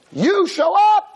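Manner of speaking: sudden high, loud retold outburst; heavy rebuke. God contrasts complaining Moshe with the patient forefathers, rebuking. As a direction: round on the upstart accusingly. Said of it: "giving Moshe Rabbeinu heavy, heavy, heavy musar".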